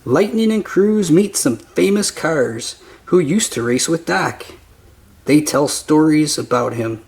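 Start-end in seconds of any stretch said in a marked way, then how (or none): none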